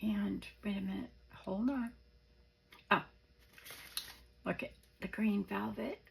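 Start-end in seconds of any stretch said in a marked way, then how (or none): none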